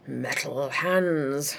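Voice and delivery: dark, very slow and low; darkly. Acted. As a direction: let it land darkly. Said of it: "said the Doctor darkly".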